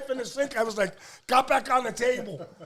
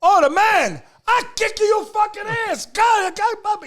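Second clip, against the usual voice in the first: dad's enraged yell, higher and louder; furious shout; 12 semitones higher, 6 dB louder than usual. Retold or imitated. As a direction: explode at the customer like a hot-headed Italian dad. Enraged dad in broken English.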